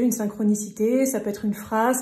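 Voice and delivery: normal talking voice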